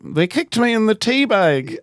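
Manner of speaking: mock British accent. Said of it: "You wanna give it a shot?".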